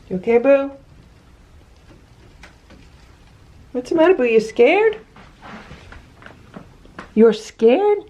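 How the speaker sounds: pet talk. Real, not acted. Owner coaxing her scared cat Boo, high cooing pet talk. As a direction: coo up high to a frightened cat.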